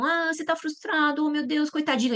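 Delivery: put-on high, syrupy coddling of a child; mock pity. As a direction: overdo the sympathy, mockingly. Mocking an overprotective parent.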